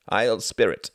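plain reading voice

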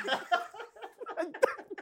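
high-pitched cackle of disbelief; shrieking laugh. Real, not acted. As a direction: burst out in a squealing laugh. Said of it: "Malcolm was pissed".

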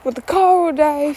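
high, loud dramatic wail about being stuck; mock melodrama, voice up high and loud; melodramatic panic. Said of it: "I start to get a little bit dramatic".

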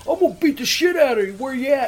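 high, quick, imitated angry threat; retold furious threat. Enraged prank-call victim threatening his tormentor, retold angry. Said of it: "this guy's just getting fucking madder than hell".

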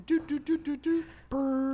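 high, soft sung-out transition effect; light high "doo-doo" flash-forward noise; flash-forward jingle. Pretend. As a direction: mimic a time-jump sound effect with a trill.

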